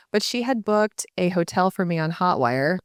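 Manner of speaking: ordinary delivery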